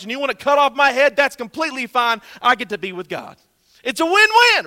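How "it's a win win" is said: excited. Real, not acted. Triumphant punchline about martyrdom.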